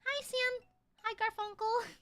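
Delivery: high, sing-song hello; polite greeting. Retold or imitated. Office worker greets two visiting coworkers, politely high-pitched.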